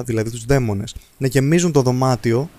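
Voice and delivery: ordinary delivery, nothing marked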